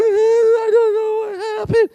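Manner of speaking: high, slow, tearful whine; mock crying whine. Complaining fat person pitying themselves, high mock-tearful whine. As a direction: whimper it like a crybaby. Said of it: "complain too much".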